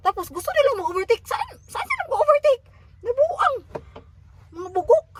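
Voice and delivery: high, loud outraged outburst; indignant rant. Real, not acted. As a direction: vent loudly at the reckless drivers. Angry rant about overtaking drivers. (galit na sigaw, mataas at malakas)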